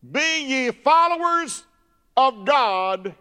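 high, loud scripture shout; full-force preaching. Real, not acted. Preacher hitting chapter five verse one, loud shouted declamation.

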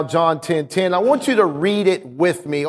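ordinary talk

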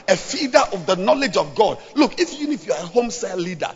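loud, rising exhortation to the leaders; preaching at full force. Acted. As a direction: drive it home like a revival preacher.

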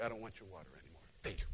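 quiet clipped brush-off; a hint of a cold thank-you; curt refusal. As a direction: turn him down coldly but politely. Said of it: "And I'm like".

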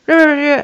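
high, loud growl mimicking her shouting; mock angry growling. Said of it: "like, shouting".

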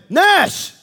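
sharp call across the party; father calling out to his son. Retold or imitated. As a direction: call the boy's name, loud. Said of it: "And I said".

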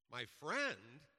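high, loud incredulous echo; surprised exclamation. Retold or imitated. Old preacher startled at the word friend, exclaiming. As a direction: toss the word back in disbelief. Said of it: "exclaimed the old preacher".

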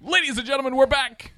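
high, loud announcer call; radio announcer voice. Pretend. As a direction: shout the welcome back like a radio host. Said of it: "slip into radio guy".

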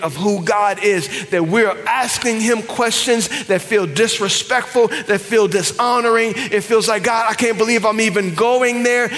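builds to high, loud preaching; rising preaching. Acted. Preacher voicing doubts of grieving people, rising preaching. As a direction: swell into the shout. Preacher rises to full force.

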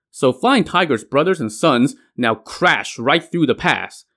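ordinary delivery

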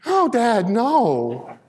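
high, drawn-out mock whine; imitated crying son. Upset son protesting to his dad, imitated wailing. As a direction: act out a tearful kid's protest. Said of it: "started crying. And he's like".

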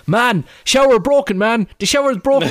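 put-on high, loud toddler yelling; child shouting. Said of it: "Cormac started shouting".

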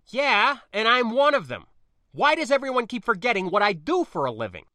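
high, loud, indignant comeback; exasperated retort. Acted. Spacer defending their profession to niece, exasperated. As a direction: argue back, fed up at being underestimated.

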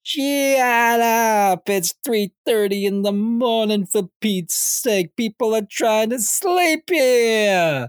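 high, drawn-out angry yell. Retold or imitated. Woken neighbor yelling at the laughing Joker to shut up. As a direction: holler it high and stretch out the last words. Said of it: "and someone yelling".

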